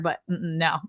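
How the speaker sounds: ordinary talk, nothing marked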